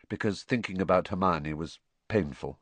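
ordinary delivery

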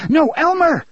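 high, loud desperate cry; near scream. Acted. Mother stopping husband from grabbing son, near scream. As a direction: scream at him to stop. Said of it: "Jake's mother almost screamed".

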